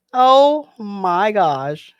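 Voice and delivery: slow, stretched gasp of dismay; drawn-out oh my gosh. Real, not acted. Viewer reacting to chemical chips, slow dismay. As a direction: let it hang in disbelief.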